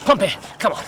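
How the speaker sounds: loud urgent call to a dog. Acted. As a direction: hurry the dog along. Urging the dog to hurry.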